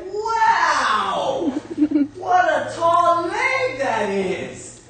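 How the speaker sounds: high, loud and slow, gasping in wonder; a tiny climber awestruck by a giant leg; amazed little boy. Acted. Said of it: "And he was like".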